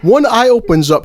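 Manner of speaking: ordinary delivery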